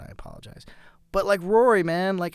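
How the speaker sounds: ordinary talk, nothing marked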